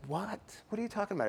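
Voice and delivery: high-pitched acted disbelief; baffled protest. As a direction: shoot the pitch up, playing clueless. Acted baffled reply to wife.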